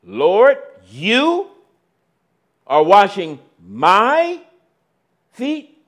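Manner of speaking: loud, rising, astonished; Peter, shocked as his teacher kneels to wash his feet, loud disbelief; surprised question. Acted. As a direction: ask it as if you can't believe your eyes. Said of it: "Peter's surprise question".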